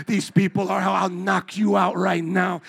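loud, lightly put-on Puerto Rican accent; hint of Puerto Rican street talk. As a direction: issue a threat in an imitated Puerto Rican accent. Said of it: "This is my accent".

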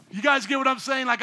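ordinary delivery

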